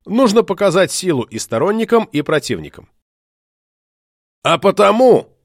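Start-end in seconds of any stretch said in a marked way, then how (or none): 4.4-5.4 loud, high growl. Acted. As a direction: growl it at the crowd. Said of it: "прорычал он".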